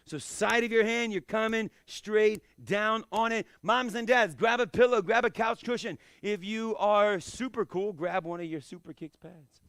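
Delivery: ordinary delivery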